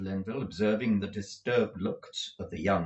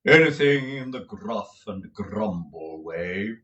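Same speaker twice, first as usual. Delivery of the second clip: slow, theatrical actor's voice; pompous tragedian; 7 dB louder, slower than usual. A narrator's reading. Lenville admiring Nicholas's room, slow and grand. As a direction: roll it out like a stage tragedian.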